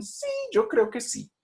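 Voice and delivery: "Sí, yo creo que sí" said slowly and very high; confident high-pitched yes. Shown as a demonstration. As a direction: lift it up high and slow. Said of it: "suben la frecuencia fundamental también y dicen".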